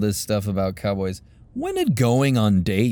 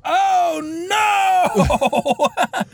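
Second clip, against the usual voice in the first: panicked shout. Retold or imitated. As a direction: yell it like a driver who can't stop. Train driver seeing people tied up.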